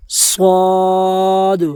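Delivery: "صاد" slow, with a thick whistled s; heavy whistling s. Shown as a demonstration. Teacher showing the heavy letter: a slow, thick "صاد". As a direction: hiss "صاد" heavily and slowly. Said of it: "سیٹی کی آواز ... لفظ موٹا پڑ جائے گا".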